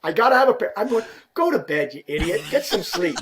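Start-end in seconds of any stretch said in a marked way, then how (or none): 0.0-0.8 high, loud, desperate begging; pleading shout. Retold or imitated. Player begging the rep at three in the morning, high pleading cry. 1.3-3.2 laughing retort. Retold or imitated.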